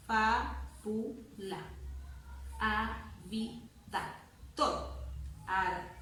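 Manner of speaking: "fábula" and "avida" chopped into slow separate syllables; slow syllabifying, each piece on its own. Shown as a demonstration. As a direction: sound out each syllable with gaps. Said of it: "Voy a silabear".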